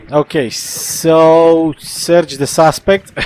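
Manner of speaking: slow, panting words after running; breathless from running up the stairs; out of breath. Real, not acted. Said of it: "Whew, I'm out of breath".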